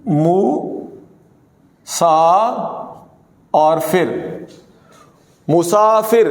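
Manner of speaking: مو سا فر split into slow syllables with long pauses, then مسافر whole; teacher breaking مسافر into syllables for students. Shown as a demonstration. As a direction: sound out مسافر part by part. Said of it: "دیکھیے گا".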